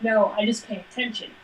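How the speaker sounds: ordinary delivery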